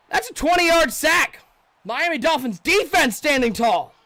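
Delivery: loud, high, slow play-by-play shout; excited call. Real, not acted. Streamer calling a big sack play-by-play, loud and excited.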